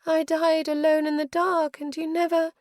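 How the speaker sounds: high, tearful longing; tearful mother. A narrator's reading. Mother's ghost weeping to her daughter, high and tearful. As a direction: breathe it through tears.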